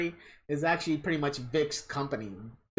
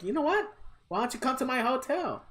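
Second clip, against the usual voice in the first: higher, mock suave invite; mock invitation; 7 semitones higher than usual. Voicing the actor inviting fans, mock suave. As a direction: put on a cheesy come-on voice. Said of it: "he's like".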